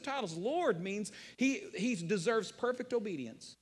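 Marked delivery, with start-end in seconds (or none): none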